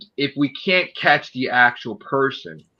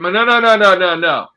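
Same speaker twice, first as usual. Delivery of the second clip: higher, louder repeated no; angry refusal; 5 semitones higher, 5 dB louder than usual. Retold or imitated. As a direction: refuse loudly and heatedly. Heated retold argument.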